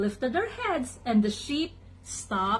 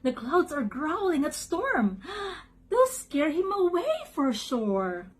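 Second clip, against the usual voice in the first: higher, excited duck voice; lightly voiced excited duck; quacked; 6 semitones higher than usual. A narrator's reading. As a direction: predict it eagerly, pitched up. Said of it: "Doug quacked".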